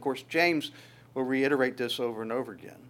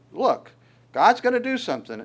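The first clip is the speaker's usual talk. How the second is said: rising, higher-pitched insistence; a higher, eager reassurance; Moses insisting; 7 semitones higher than usual. Retold or imitated. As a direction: push it up with confident urgency. Moses answers complaining people.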